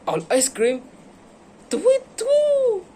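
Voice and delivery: very high, loud hooting cry; panicked owl. Acted. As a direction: squawk it out like a startled owl.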